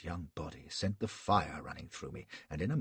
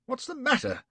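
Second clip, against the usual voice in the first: startled man; 8 dB louder than usual. A narrator's reading. Flashman startled.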